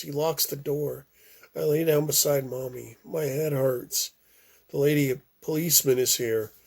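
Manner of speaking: usual speaking voice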